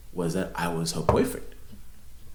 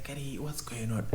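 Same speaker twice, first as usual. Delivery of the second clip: whisper; 5 semitones higher, 11 dB quieter than usual. Retold or imitated. Whispering to friend in club.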